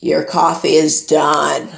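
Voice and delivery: loud, slow, low machine announcement; talking appliance. Retold or imitated. Machine announces coffee is done.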